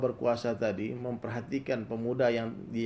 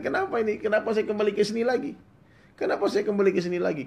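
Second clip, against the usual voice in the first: high, loud bewildered cry; young man waking in his old hut, crying out in disbelief; panicked shout; 10 semitones higher, 7 dB louder than usual. Acted. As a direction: shout in shock at waking up back home. Youth wakes home in panic.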